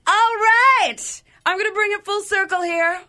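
big hyped-up radio shout, high and loud; excited. Real, not acted. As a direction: announce it like a pumped DJ. DJ hyped announcer burst.

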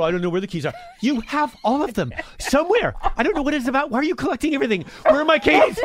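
high, fast-rising exasperated voice; frantic retold rant. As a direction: act out a frantic search for lost keys. Retelling frantic search for keys.

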